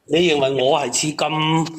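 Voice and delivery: ordinary delivery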